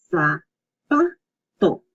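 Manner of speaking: "zapato" slowly as za, pa, to; syllable by syllable. Shown as a demonstration. Teacher repeating a word for practice, "zapato" broken into syllables. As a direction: clear pauses between the syllables of "zapato". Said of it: "you separate the two".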